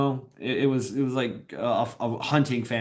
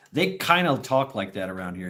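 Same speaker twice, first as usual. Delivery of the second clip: ordinary delivery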